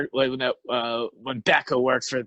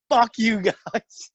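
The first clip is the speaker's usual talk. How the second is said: higher, drawn-out mock protest; mock exasperation; 6 semitones higher, much slower than usual. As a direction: imitate a pestered friend, slow and higher. Acting out the pestered hungry friend.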